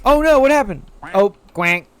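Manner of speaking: high, loud alarm; alarmed outburst. Real, not acted. Streamer startled by the game, loud high alarm. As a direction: cry out in sudden dismay.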